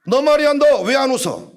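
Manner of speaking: loud, high mock scolding; acted rebuke. Preacher demanding why someone is not laughing, acted loud rebuke. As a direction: imitate a preacher pouncing on a listener. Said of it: "막 이렇게 책망을 하고".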